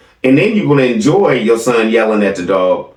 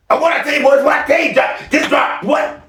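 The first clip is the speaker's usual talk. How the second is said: mock yell; 12 semitones higher than usual. Mocking Tim yelling at boys.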